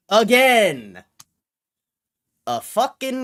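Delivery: a loud, fed-up shout; exasperated yell. Real, not acted. Streamer fed up with GameStop, loud exasperated yell.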